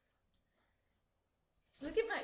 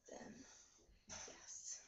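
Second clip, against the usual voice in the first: whisper; 3 semitones lower, 19 dB quieter than usual. Pretend. Conspiratorial whisper.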